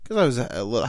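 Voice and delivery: ordinary talk, nothing marked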